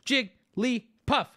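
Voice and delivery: JIGGLYPUFF split into three beats with big spaces; broken into syllables. Shown as a demonstration. Streamer leading everybody in chat, syllables spaced out. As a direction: chant Jigglypuff slowly, one syllable at a time. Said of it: "Big spaces in between them".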